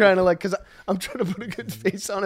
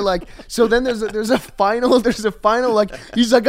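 normal talking voice

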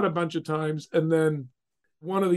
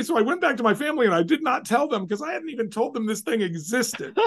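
ordinary delivery

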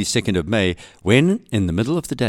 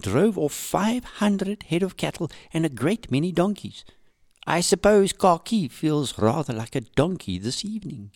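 put-on lighter, higher voice for the young diarist; reading a diary; 8 semitones higher than usual. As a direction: read it as her cheeky diary entry. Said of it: "today she entered".

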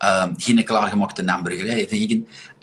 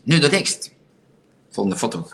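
ordinary talk, nothing marked

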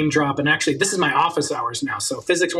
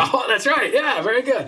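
pleased surprise; 8 semitones higher than usual. Real, not acted. Host praising guest's correct answer.

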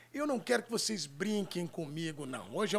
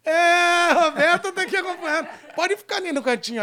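calling out; 9 semitones higher, 12 dB louder than usual. Real, not acted. Host calling to Roberta off-camera.

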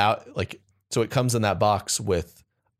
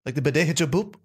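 imitated French accent; 6 semitones higher than usual. Shown as a demonstration. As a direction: demonstrate a lilting French accent. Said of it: "grad school with a French guy".

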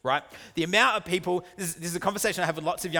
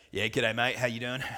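lightly put-on lower matey voice; tradie-chat voice; 3 semitones lower than usual. Husband phoning a tradie, mock matey voice. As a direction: slightly drop the voice to sound like one of the tradies. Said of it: "You drop your voice an octave".

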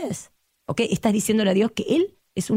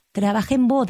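usual speaking voice

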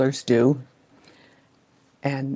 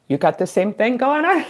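higher, lightly acted puzzled question; mock surprised question; 7 semitones higher than usual. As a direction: voice a sibling's surprised look, pitched up. Siblings' puzzled look, voiced.

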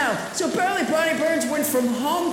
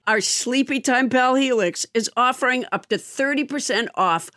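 normal talking voice

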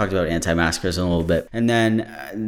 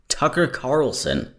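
careful retry; 6 semitones higher, slower than usual. Real, not acted. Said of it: "I can't say that name".

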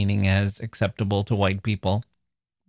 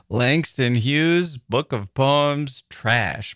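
very slow, savoring each word; slow headline; much slower than usual. Retold or imitated. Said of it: "This is in all caps".

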